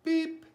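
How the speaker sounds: high, loud little "beep"; truck beep. A narrator's reading. Little blue truck greeting the animals, high beep. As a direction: pipe up with a short high toot. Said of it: "said Blue".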